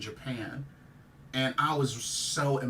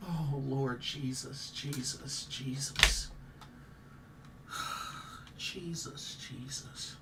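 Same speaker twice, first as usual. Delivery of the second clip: soft, fading pleas to Jesus; real grief, barely voiced; grieving murmur; 7 dB quieter, slower than usual. Real, not acted. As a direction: pray under your breath in sorrow. Praying in grief.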